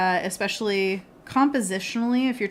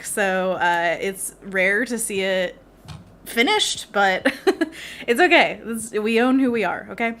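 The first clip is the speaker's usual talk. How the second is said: usual speaking voice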